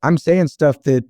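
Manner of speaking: ordinary delivery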